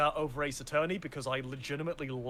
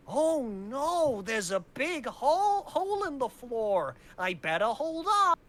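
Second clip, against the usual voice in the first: high, nasal-free cartoonish boy voice, much higher; overacted anime dub; 9 semitones higher, 3 dB louder, slower than usual. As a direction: ham up a dubbed anime boy. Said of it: "he sounds a little bit like this".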